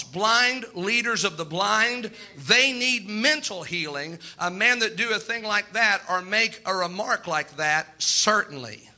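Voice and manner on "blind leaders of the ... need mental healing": slow emphatic preaching. Real, not acted.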